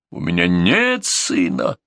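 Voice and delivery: slow, emphatic denial; mild outcry, cutting the other short; understated vehement protest; crying out. A narrator's reading. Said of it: "вскричал Густав Решительный".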